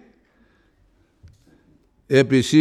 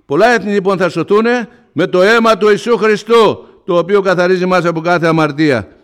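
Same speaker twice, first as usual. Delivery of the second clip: loud, high sermon climax; impassioned proclamation, loud and high; full-force preaching; 6 semitones higher, 7 dB louder than usual. Acted. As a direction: preach it out to the whole church.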